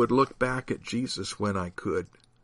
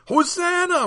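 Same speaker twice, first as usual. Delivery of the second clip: high-pitched crowd shout; 4 dB louder than usual. A narrator's reading. Crowd hailing Jesus on the road, high shout. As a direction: call it out high over the crowd. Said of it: "went up the shout".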